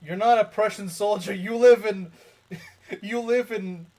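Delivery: loud, high meme quote, breaking into a laugh; mock rebuke. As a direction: shout a mock scolding through amusement. Quoting a meme's indignant rebuke.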